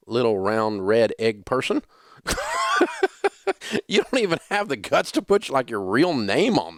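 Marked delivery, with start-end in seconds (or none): 2.3-3.8 sudden high-pitched laugh; amused high laugh at the troll. Real, not acted. Laughs at own put-down.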